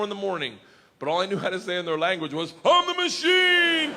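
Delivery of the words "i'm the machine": high, slow, roaring battle cry; triumphant shout. Retold or imitated. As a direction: bellow it like a war cry. Repeating catchphrase, shouting.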